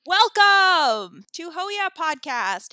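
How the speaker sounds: loud, slow, drawn-out show opener; podcast intro. Acted. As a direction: announce the show like a radio host. Podcast host opens the show.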